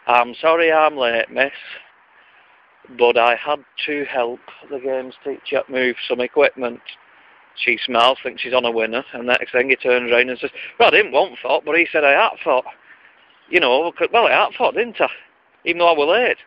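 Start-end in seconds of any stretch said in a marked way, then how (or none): 0.1-6.8 slow, careful Wigan lad reciting; careful corrected Wigan schoolboy. Retold or imitated. Lad reciting the corrected line to the teacher, slow Wigan. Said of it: "finally drums it into his head". 10.8-16.4 higher, louder cheeky Wigan schoolboy; broad Wigan lad. Retold or imitated. Schoolboy answering the teacher back in broad Wigan. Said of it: "drum an accent out of a Wigoner".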